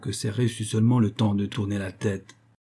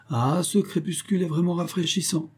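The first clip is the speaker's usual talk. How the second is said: lightly voiced proud underworld king; a hint of a boastful king; king Pluto voice; 7 semitones higher than usual. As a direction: coax her with grand promises. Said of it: "dit le roi Pluton".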